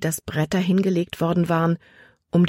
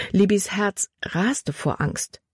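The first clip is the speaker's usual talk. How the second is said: ordinary delivery, nothing marked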